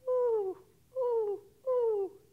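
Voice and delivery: high, loud imitated hoots; drawn-out, high owl "whoo". A narrator's reading. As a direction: hoot like an owl, three long calls. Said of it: "asked the owl".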